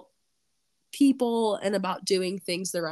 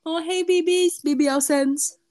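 excited squeal; 8 semitones higher, 4 dB louder than usual. Acted. Playful silly greeting shout.

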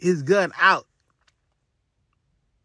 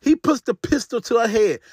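ordinary talk, nothing marked

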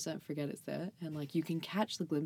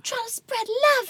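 high, slow mock preacher voice; 9 dB louder, slower than usual. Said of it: "You're like".

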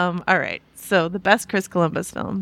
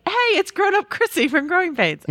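surprised recognition; 8 semitones higher than usual. Retold or imitated. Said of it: "I was like".

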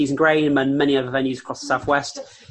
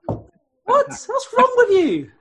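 incredulous; 6 dB louder, much slower than usual. Real, not acted. Mock outrage at co-host.

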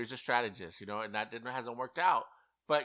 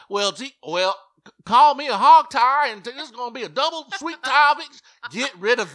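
mock country houseguest; 11 semitones higher, 13 dB louder than usual. To the casting producers, mocking Jasmine's diary room in a loud, fast country gush. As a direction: gush it fast like an over-the-top country girl. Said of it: "if she's talking like this in the audition".

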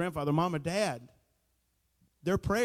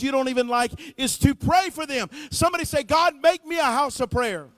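high, loud, impassioned sermon; preaching at full force; 9 semitones higher, 7 dB louder than usual. Acted. Pastor urging the church to pray, loud impassioned preaching. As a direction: preach it out with rising fire.

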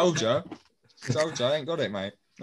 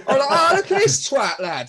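much higher, laughing mock Scottish accent; terrible Scottish accent; 9 semitones higher, 5 dB louder, slower than usual. Mocking mates as a Scottish geezer, bad Scottish accent. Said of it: "put on a terrible Scottish accent".

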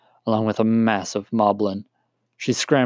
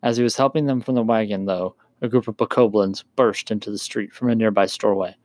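usual speaking voice

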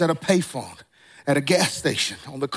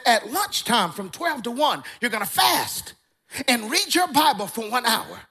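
high, emphatic imitation of an elder; strong-voiced old elder; 5 semitones higher than usual. Elder instructing a young man to fast, high emphatic voice. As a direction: mimic an old preacher giving orders. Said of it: "He had a s-, strong voice".

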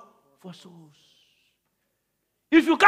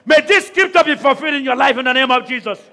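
higher and louder, slow, fervent shouted prayer; preaching at full force; 10 semitones higher, 11 dB louder than usual. Acted. Pastor to congregation, praying over them, shouted preaching at full force.

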